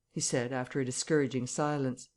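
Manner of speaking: usual speaking voice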